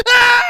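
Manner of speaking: sudden very high shriek of pain; a shrill cry as the fingers break; pained yelp. Acted. Newcomer shrieking as his fingers break.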